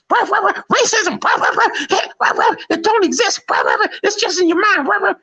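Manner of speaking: high yapping dog voice, barking between words. Retold or imitated. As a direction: bark it out like a yappy dog. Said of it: "you can hear the barking in between".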